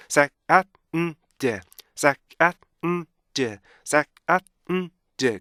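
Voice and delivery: SAND chopped into sae, at, mm, deu; "sand" in four detached beats, hummed N; segmented sand. Shown as a demonstration. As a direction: punch each piece of "sand" separately with stops between. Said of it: "리듬이 두 개여서 sand".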